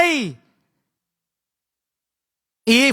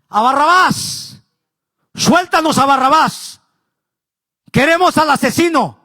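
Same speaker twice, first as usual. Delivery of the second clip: crowd demand; 3 semitones higher than usual. Retold or imitated. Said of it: "toda la gente empezaron a decir".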